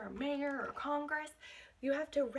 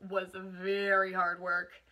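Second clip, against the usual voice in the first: put-on reading a picture book aloud to children; expressive read-aloud, savouring each list item; picture-book narration for a class; storybook reading; 6 dB louder than usual.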